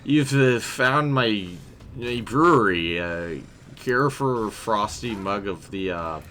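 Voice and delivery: slurred tipsy brewer; drunk voice. Acted. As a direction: slur it like a merry barkeep. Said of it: "Um, that's my drunk".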